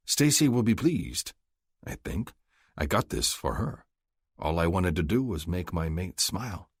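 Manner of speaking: ordinary delivery, nothing marked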